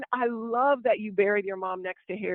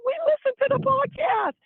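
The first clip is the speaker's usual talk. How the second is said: high, loud mock gushing; excited fan imitation; 9 semitones higher, 5 dB louder than usual. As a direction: play a thrilled listener meeting the host, pitched up. Retold fans gushing about podcast.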